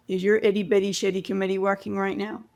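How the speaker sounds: quick, light imitation of a Canadian accent; friend's question in a loose Canadian accent. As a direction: toss off the line in a mock Canadian accent. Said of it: "Forgive the Canadian accent".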